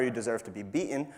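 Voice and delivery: ordinary talk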